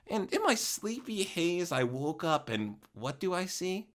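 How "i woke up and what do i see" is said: plain reading voice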